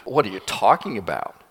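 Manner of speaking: low, incredulous question; disbelieving. Acted. Servant looks back at the prophet, disbelieving. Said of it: "with disbelief".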